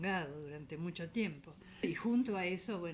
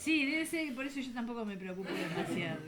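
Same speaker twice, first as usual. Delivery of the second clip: laughing; 5 semitones higher, 3 dB louder than usual. Real, not acted. Laughs at a friend's joke.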